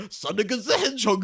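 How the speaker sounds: loud, high mock Japanese accent; hammed-up Japanese accent imitation. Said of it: "Sonic the Hedgehog in a Japanese accent".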